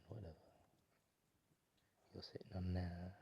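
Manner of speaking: soft whispered meditation voice; guided meditation. Acted. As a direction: lull them into relaxing, softly. Leads a guided visualisation.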